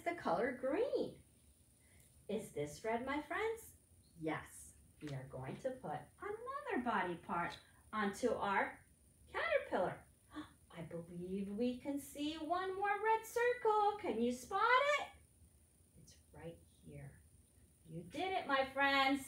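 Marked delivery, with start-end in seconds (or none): none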